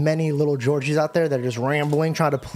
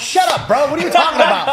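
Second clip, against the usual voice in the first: loud, much higher, incredulous outburst; mock exasperated reply; 13 semitones higher, 7 dB louder than usual. Guy mocking a classmate who explains too much, loud and incredulous. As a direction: blurt it like a confused classmate. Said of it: "one of those dudes in the class where they're like".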